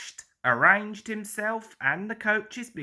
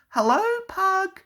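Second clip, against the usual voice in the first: high, a bit louder hello; whistling greeting; 13 semitones higher, 4 dB louder than usual. A narrator's reading. Percy greets his friend Pug, high whistle-like hello. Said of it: "whistled Percy".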